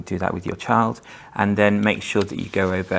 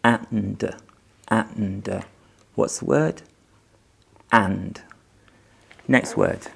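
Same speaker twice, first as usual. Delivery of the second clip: put-on reading to a toddler; much slower than usual. Reading practice with young child.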